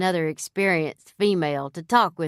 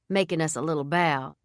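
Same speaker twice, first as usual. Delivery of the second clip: plain reading voice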